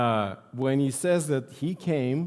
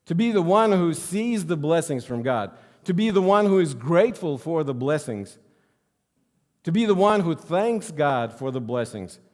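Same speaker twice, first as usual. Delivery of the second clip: preaching refrain; 4 semitones higher, 5 dB louder than usual. Acted. Emphatic repeated refrain.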